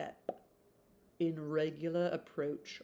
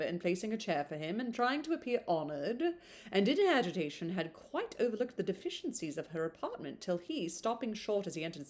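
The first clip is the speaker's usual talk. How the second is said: ordinary delivery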